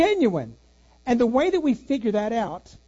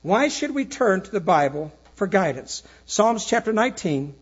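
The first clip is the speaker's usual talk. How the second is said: louder, firm declaration of faith; firm preaching; 4 dB louder than usual. Acted. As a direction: state it as settled truth to the flock. Pastor closes with firm declaration.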